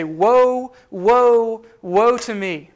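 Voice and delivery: preacher voicing a loud lament; cried-out lament. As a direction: call out each "woe" like a doomed people. Voices people facing total judgment.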